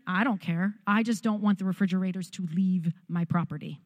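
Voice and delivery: ordinary talk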